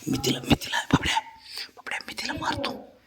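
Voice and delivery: put-on conspiratorial whisper to the child; hushed pretend plot against mom; playful whisper. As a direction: whisper the mock threat like a shared secret.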